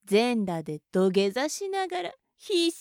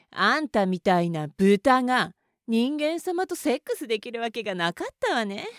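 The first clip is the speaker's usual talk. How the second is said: ordinary talk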